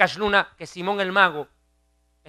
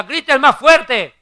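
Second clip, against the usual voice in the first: raised, drawn-out taunting call; mocking Elijah; 7 semitones higher, 9 dB louder, much slower than usual. Elijah taunting the prophets of Baal, mocking loud call. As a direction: goad them loudly, slow and higher. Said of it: "Elías dice que les decía".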